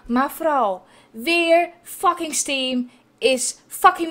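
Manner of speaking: loud, slow swearing rant; angry rant. Real, not acted. Streamer rants loudly at Steam for failing again. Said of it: "heel erg aan het schreeuwen".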